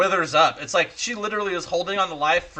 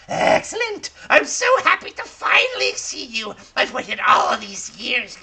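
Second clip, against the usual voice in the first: much higher, shrieking with delight; overjoyed crone; 7 semitones higher, 3 dB louder than usual. Acted. Old crone thrilled to finally meet the boy, high shriek. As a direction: squeal like a hag whose wait is over. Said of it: "And she's like".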